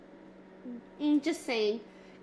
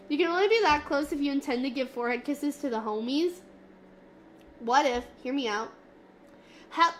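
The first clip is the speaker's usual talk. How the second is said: ordinary talk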